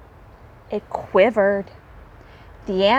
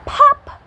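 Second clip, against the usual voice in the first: very high, louder pop; 5 dB louder than usual. A narrator's reading. Narrator to listeners, a comic pop. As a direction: pop the word. Sound effect in story.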